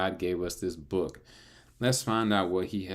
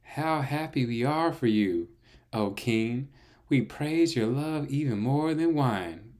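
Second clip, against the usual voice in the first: put-on chorus of young women; 7 semitones higher, 5 dB louder, slower than usual.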